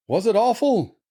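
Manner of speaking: high put-on voice, falling at the end; loving concerned brother. A narrator's reading. Laurie asking his crying sister, high concern. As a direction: ask tenderly in a high character voice.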